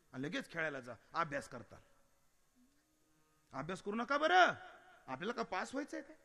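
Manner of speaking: mock-polite mother, voice dropping low between lifts; exasperated sarcasm, telling the child the opposite; mother's angry sarcasm. Fed-up mother sarcastically telling her child not to study. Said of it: "ही उपरोधाची भाषा याला म्हणतात".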